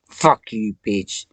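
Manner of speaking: loud, snarled curse; furious shout. Real, not acted. Cursing Satan out of her head, furious shout. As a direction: spit it out in rage.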